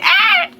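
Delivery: high short mock scream; retold scream. Retelling screaming back at the man, high mock yelp. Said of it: "I screamed too".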